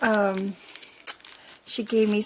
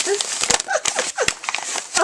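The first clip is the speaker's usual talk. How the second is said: high delighted giggle; 11 semitones higher than usual. Real, not acted. Giggle on seeing bag.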